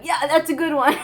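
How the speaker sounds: cackling sidekick egging shame on, laughing; mocking hype man. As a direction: hype it up like a smug buddy laughing along. Fatalism voiced as a hype man.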